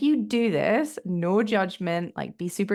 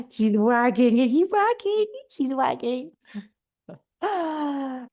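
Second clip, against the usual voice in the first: high, excited voice for the dog; Maple dog voice; 7 semitones higher, slower than usual. Real, not acted. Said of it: "talking in my Maple dog voice".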